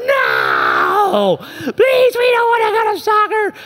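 put-on high, loud crying whine of kids; whining kids. Kids wailing at dad in the minivan, loud crying whine. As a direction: wail it like kids in a meltdown. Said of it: "They're like".

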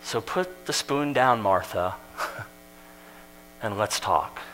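low and soft, almost whispered; Jesus tenderly calms busy Martha; soft, coaxing invitation. Retold or imitated. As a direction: lay the spoon down, gently. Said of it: "Jesus gently invites Martha".

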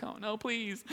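small, very quiet mock-frightened plea; hushed plea. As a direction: plead under your breath. Frightened plea in retold chase.

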